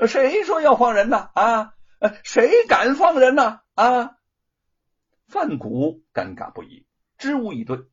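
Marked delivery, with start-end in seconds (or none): 0.1-4.2 high, loud bullying shout. Acted. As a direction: bellow it as you storm in. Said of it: "大声的嚷嚷道".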